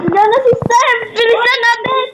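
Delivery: high urgent calling out; yell, hurrying a friend; excited shout. Pretend. Being chased in game.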